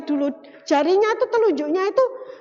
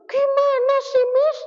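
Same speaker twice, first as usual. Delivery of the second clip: higher, louder demanding whine; demanding child; 10 semitones higher, 5 dB louder, slower than usual. Retold or imitated. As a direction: press the teacher like a pushy kid. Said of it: "Ada yang demand". (anak menuntut dengan nada tinggi)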